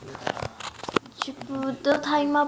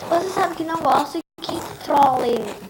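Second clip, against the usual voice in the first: higher, louder mock scolding; acted annoyance; 4 semitones higher, 6 dB louder than usual. Friend complains about being pranked, acted annoyance.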